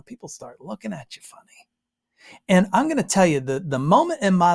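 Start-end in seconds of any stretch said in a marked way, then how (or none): 0.0-2.4 near-whispered aside; hushed. Acted. Preacher on locals judging outsiders, hushed. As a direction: drop almost to a whisper.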